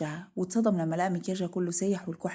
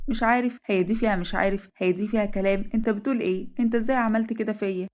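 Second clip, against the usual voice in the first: indignant disbelief; 2 semitones higher, 4 dB louder than usual. Acted. Wife confronting husband over his betrayal, indignant. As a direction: throw the excuse back as sharp questions.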